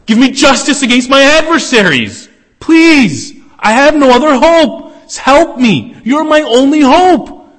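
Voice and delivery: high, loud pleading cry; desperate scream. Acted. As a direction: beg for your life at full voice. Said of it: "to scream out".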